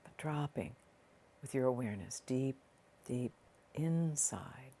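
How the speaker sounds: soft, unhurried meditation voice with long pauses; guided meditation. Real, not acted. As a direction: ease the listener gently inward, leaving space between phrases.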